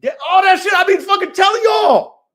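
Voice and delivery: loud, high-pitched ranting outburst; indignant holler; heated shout. Real, not acted. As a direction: shout it at them, fed up. Heated rant at his listeners.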